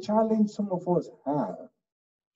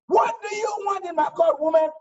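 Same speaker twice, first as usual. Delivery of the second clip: higher, loud exasperated judge; angry judge; 12 semitones higher, 3 dB louder than usual. Acted. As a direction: bark at the nagging widow. Impatient judge yells at widow.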